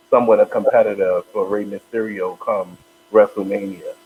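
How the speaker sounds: ordinary talk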